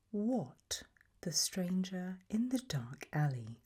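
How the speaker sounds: ordinary talk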